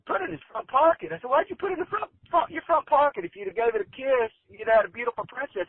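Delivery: high, astonished protest; incredulous. Retold or imitated. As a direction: squeak out the outrage at the old man's choice. Stunned reaction to the punchline.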